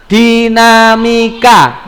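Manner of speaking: slow, drawn-out "dinamika"; measured drilling of the term; emphatic key answer. Shown as a demonstration. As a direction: stretch "di-na-mi-ka" syllable by syllable. Teacher drills the answer word.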